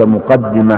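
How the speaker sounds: مقدمة said muqaddima, with i after the dd; kasra on the dal. Shown as a demonstration. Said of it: "بكسر الدال".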